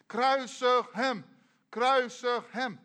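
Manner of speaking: loud, high, slow mob chant; crowd shouting. Acted. Crowd before Pilatus's palace demands crucifixion, shouting. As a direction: shout it like an angry crowd, drawing out each word. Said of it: "Jij moet ook meeschreeuwen".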